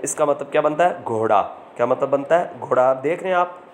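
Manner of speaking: ordinary delivery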